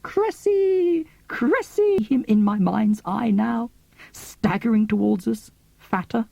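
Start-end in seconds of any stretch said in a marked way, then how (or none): 0.0-1.1 much higher called-out name; crying out. Retold or imitated. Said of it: "crying out". 1.3-2.0 much higher called-out name, again pleading; crying out. Retold or imitated. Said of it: "crying out".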